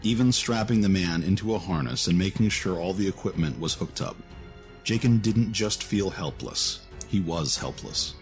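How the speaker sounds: ordinary delivery, nothing marked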